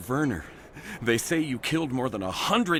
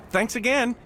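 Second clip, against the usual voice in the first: excited fan shout; 12 semitones higher, 5 dB louder than usual. Acted. Starstruck fan calls after Shepard.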